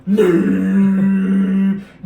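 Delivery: a loud droning bagpipe hum; imitated bagpipe. Answering the Scottish joke with a loud mock bagpipe drone. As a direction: drone like a bagpipe through the nose. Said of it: "It's my bagpipe".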